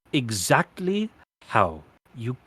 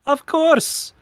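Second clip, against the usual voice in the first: delighted; 5 dB louder than usual. Acted. Queen proud of sinking ship.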